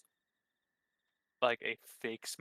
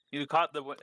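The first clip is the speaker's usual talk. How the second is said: ordinary delivery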